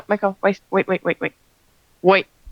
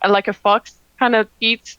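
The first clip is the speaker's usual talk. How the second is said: usual speaking voice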